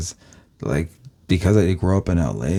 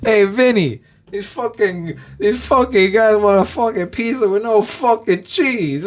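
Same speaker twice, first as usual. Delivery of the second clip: high, slow, hammed-up complaining waitress; mock Italian waitress; 13 semitones higher, slower than usual. Waitress griping to the cook about the order, hammed-up high voice. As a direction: ham up an old-school Long Island Italian waitress.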